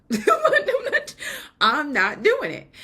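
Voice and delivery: high, loud protest through laughter; laughing refusal. Retold or imitated. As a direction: laugh while digging your heels in. Retold refusal to God, laughing.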